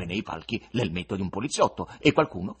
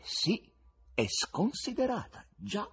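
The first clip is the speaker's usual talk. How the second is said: musing Voland; 10 semitones higher than usual. Acted. Voland reflecting on Muscovites, lightly voiced. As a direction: reflect aloud.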